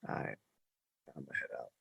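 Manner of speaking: low, very soft resignation; retold subdued exit. Patient leaving his family at the hospital, low and subdued imitation. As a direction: imitate someone quietly excusing himself.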